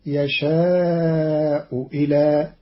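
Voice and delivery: "يشاء إلى" slowly, hamza turned into a kasra waw; slow, "يشاءُ وِلى" with waw. Shown as a demonstration. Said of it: "بإبدال الهمزة واوا مكسورة". ("يشاء إلى" ببطء بإبدال الهمزة واوا مكسورة)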